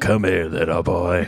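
sly creepy beckon; creepy stranger voice. Pretend. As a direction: lure in a creepy tone.

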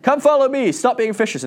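high, loud summons; hammed-up high call. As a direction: call them over from afar. Acting out Jesus calling the fishers.

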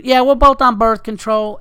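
high, loud, matter-of-fact Southern voice; pushy Southern girl. Retold or imitated. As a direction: repeat it deadpan.

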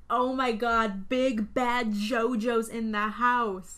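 loud, drawn-out mock awe; gagged crowd. Bikers gagged at the clown showing up, loud drawn-out hype. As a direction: hype it up slowly like an impressed crowd. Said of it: "gagged. They love it".

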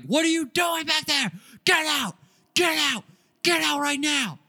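high-pitched shouted order to leave; retold screaming. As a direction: yell it like you're throwing out a trespasser. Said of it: "He's literally screaming at me".

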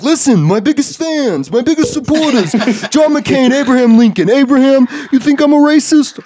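loud, high-pitched Trump bluster; hammed-up Trump, very high and loud; Trump impression. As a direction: shout it like Trump addressing a rally of fans. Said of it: "could one hundred percent see Donald Trump".

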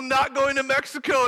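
ordinary talk, nothing marked

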